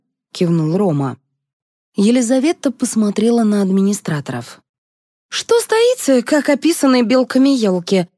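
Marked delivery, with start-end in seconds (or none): 5.3-8.1 very high, exasperated scolding. A narrator's reading. Liza scolding the idle administrators, exasperated. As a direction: snap them out of standing idle.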